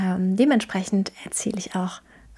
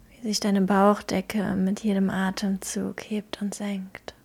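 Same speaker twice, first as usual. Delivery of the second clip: calm, gentle guiding through a visualisation; guided meditation; 3 dB quieter than usual. Acted.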